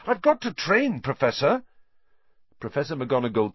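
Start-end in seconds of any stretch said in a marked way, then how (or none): 0.1-1.6 high, indignant plea; outraged appeal, rising at the end. A narrator's reading. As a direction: protest urgently to a teacher, pitch raised.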